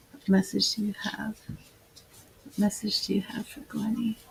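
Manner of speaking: soft, reverent channeling voice; hushed invocation. Acted. Healer calling on goddesses for her client, hushed and reverent. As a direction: murmur a prayer to the goddesses. Calls on spirit again softly.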